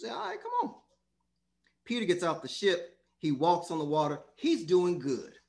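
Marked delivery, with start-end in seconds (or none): 0.1-0.7 high soft beckoning. Acted. Acted Jesus calling Peter over.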